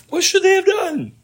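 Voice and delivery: high, loud cry of a question; loud insistent question. Real, not acted. Preacher pressing the congregation for an answer, loud and insistent. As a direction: call it out high and forcefully.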